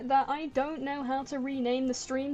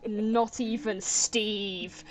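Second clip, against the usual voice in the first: solemn decree voice; 3 dB louder, much slower than usual. Acted. Archaic decree refusing hugs.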